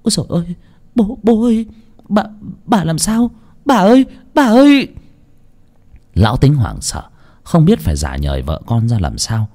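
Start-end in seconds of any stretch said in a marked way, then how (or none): 0.0-4.9 put-on high-pitched girl's frantic cries; panicked. A narrator's reading. Granddaughter rushing to her fallen grandmother, panicked. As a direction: call out in rising panic, voice high. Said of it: "cái Tình hoảng hốt lao đến".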